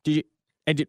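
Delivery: broken fragments with gaps, pitched a little up; call cutting out. Acted. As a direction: imitate a choppy, breaking-up connection. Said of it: "like, cut out".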